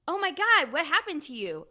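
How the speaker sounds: high, loud startled concern; voicing her own shocked question to a stranger. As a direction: blurt it out in alarm. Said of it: "I asked".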